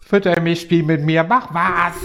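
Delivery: high, whiny doll demanding attention; talking doll. Pretend. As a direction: play a needy talking doll. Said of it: "mit unserer sprechenden Puppe". (quengelnde sprechende Puppe)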